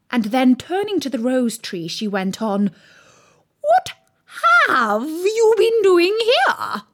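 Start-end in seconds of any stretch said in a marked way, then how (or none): 3.6-6.8 high, loud, slowly stretched question; angry demand. A narrator's reading. Queen interrogates gardeners at the rose tree, angry and loud.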